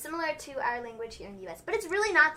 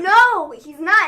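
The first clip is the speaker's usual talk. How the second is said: loud, higher denial; emphatic retold protest; 7 semitones higher, 17 dB louder, much slower than usual. Correcting Mom who thought he was dead, emphatic retold protest. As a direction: protest loudly, as in a retold argument.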